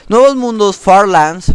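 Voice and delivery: Farlands called out high and loud, like a big reveal; the word Farlands thrown out as a bright proud shout; hyped announcement. Acted. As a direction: announce Farlands with a burst of energy. Announcing the new map name.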